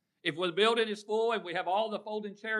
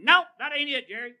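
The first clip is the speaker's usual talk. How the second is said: high, loud mock correction; imitating Harvey; 10 semitones higher, 12 dB louder than usual. Harvey correcting the preacher, high and loud. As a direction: call out like Harvey fixing the preacher's mistake. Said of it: "he'd be going".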